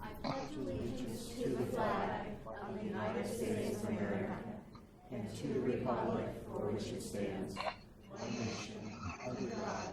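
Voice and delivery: reciting the pledge. Real, not acted. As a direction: recite it in unison, steady.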